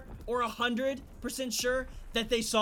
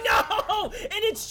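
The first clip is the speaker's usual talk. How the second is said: high yelling no through laughter; laughing shout; 11 semitones higher, 6 dB louder, much slower than usual. Real, not acted. Gets killed, shouts while laughing.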